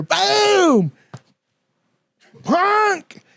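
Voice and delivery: high, punchy sound-effect shout; mock impact yell. Crew throws the drunk out the door, hammed-up impact yell. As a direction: bark it like a cartoon slam.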